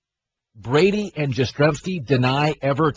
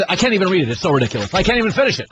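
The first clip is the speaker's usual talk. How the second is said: ordinary delivery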